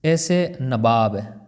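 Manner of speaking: ordinary talk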